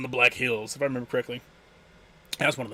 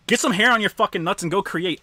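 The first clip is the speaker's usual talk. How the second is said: ordinary talk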